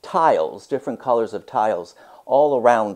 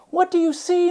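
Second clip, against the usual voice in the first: put-on much higher, bright little girl's question; curious girl; faster than usual. Myra asks the painter what he sees, curious child voice. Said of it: "Myra asked".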